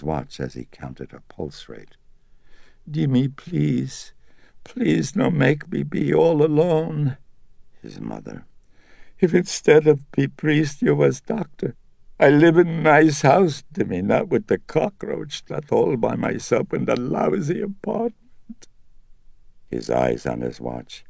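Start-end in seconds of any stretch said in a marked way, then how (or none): 2.9-7.2 put-on high, pleading old mother's voice. A narrator's reading. Mother pleading with her son, high and sorrowful. 9.2-18.7 put-on high, pleading old mother's voice. A narrator's reading. Mother pleading with her son, high and sorrowful.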